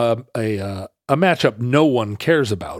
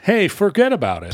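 higher-pitched mock New York accent; New Yorker catchphrase; 7 semitones higher than usual. Echoing the cohost's catchphrase, in a New York accent. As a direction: toss it back as a cartoon New Yorker. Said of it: "start saying".

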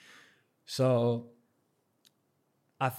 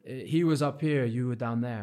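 loud, raised imitation of brushing someone off; dismissive mockery; 3 semitones higher, 8 dB louder than usual. Imitating the judge waving away his objection, loud dismissiveness. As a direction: talk over the complaint, loudly dismissive. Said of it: "he was, like, laughing, laughing at me".

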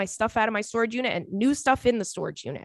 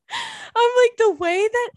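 laughing; 5 dB louder, slower than usual. Real, not acted. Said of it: "laughing at meme".

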